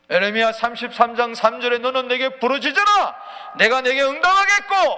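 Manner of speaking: loud, high scripture proclamation; thundering recitation; full-force preaching. Real, not acted. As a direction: shout the verse as God's command.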